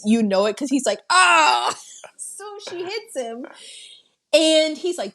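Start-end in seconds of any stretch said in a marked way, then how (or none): 0.0-1.8 a hammed-up howl as if he has just been hit; pain scream. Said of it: "every time he feels pain in this".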